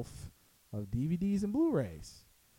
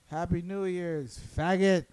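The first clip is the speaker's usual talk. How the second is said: drawn-out, higher mock greeting; retold drunken taunt; 4 semitones higher, 4 dB louder than usual. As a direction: stretch the greeting, then punch the last word louder. Imitating friend's taunting greeting.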